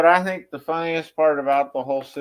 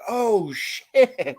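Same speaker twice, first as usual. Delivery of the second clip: surprised; 8 semitones higher, 4 dB louder than usual. Retold or imitated. Onlookers seeing the salmon escape.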